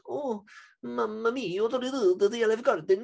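mock Italian accent. Mocking online people who slander Olive Garden in an exaggerated Italian accent. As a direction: ham up the mamma mia like a cartoon chef. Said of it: "I see people, they're like".

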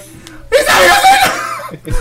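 very high, drawn-out shriek; imitated mother screaming. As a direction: wail it slowly at full volume, half laughing. Mother's scream acted out laughingly.

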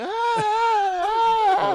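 high, loud, drawn-out wails; mock wailing scream. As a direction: keep shrieking in mock panic. Retelling continued panicked screaming.

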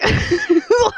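a sharp, high burst of laughter; exasperated high laugh; high frustrated laugh. Real, not acted.

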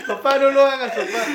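put-on a twelve-year-old begging his father to stop, high and laughing; mock kid pleading. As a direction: beg through giggles like a scared boy.

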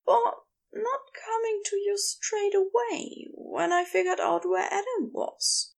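high-pitched character voice, contrite; high, regretful voice owning up to a failing; Aziraphale, earnestly apologetic. Acted.

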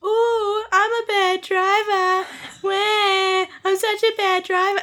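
high, slow mocking baby voice; high sing-song mockery of a bad driver; mocking whine. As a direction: mimic them like a whining crybaby. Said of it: "he's like".

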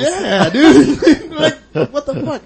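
high, laughing disbelief. Real, not acted. Recalling waking among wine bottles, laughing. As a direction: crack up while asking.

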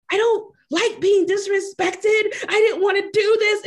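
high, loud, wounded complaint; hurt date. Retold or imitated. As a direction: whine like the offended date. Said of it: "he's like".

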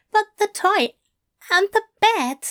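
high, fretful fussy voice; anxious angel voice. A narrator's reading. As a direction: fret in a high voice.

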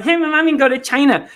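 mock toddler boast; little kid voice. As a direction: sound like an eager four-year-old. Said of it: "a little four-year-old".

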